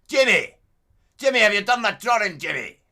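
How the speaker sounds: put-on high, loud shouting Glasgow accent; hammed-up loud Scottish shouting; angry Glaswegian. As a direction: bellow at Jimmy in a Glasgow accent. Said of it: "That's how he talks to me".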